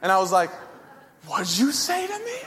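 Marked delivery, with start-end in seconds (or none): 1.3-2.4 much higher, squeaky fake-tough challenge; a scrawny kid trying to sound menacing; mock tough guy. Squaring up to mocking brother.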